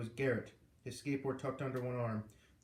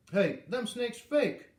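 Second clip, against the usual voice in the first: higher, louder, slow dim-witted piping; 10 semitones higher, 7 dB louder, much slower than usual. A narrator's reading. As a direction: pipe up like a slow-witted worker. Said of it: "Curly piped".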